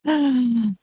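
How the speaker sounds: high tense stress laugh; nervous laugh. Real, not acted. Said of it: "Nervous stress laugh".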